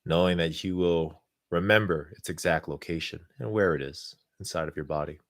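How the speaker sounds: calm, unhurried guidance with long pauses. Acted. Leads a guided meditation.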